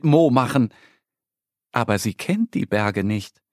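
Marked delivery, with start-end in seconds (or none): none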